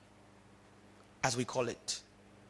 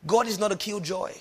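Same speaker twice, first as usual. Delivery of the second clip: usual speaking voice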